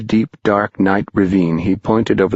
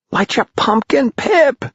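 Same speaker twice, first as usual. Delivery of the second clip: shriek. Acted. Tom shrieking down to fallen Pipkin, high and urgent. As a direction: scream down into the dark. Boys urging again.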